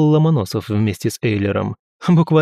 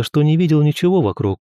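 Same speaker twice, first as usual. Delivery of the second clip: usual speaking voice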